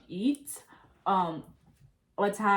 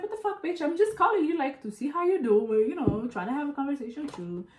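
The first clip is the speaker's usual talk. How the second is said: indignant retort; 6 semitones higher than usual. Retold or imitated. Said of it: "I was like".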